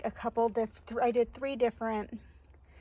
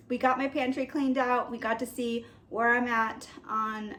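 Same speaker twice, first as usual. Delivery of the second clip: ordinary delivery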